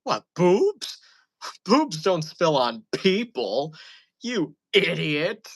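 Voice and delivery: put-on high, loud, slow drunken scolding; mock a tipsy mom calling you an idiot. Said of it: "And she goes".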